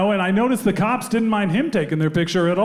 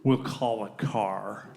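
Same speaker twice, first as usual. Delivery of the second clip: sulky cop; 8 semitones lower, 8 dB quieter, much slower than usual. Retold or imitated. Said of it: "He says".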